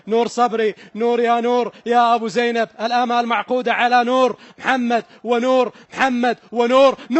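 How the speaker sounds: loud, urgent chant of the keeper's name; rising roar of hope for the keeper; excited pleading. Real, not acted. Commentator urging the keeper before the kick, loud and pleading.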